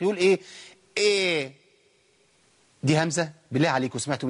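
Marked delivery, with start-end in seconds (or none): 1.0-1.5 the hamza's "a" overdone into a long "eh", إيه; exaggerated thinning. Teacher mimicking over-careful readers, exaggerated thinning on إيه. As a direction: say إيه as a too-thin opening vowel. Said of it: "قوي في الترقيق".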